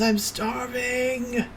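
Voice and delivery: high, very slow strained wail; desperate starving wail. Acted. Trapped son cries to the adventurer, starving wail. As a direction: stretch it into a high, hungry cry.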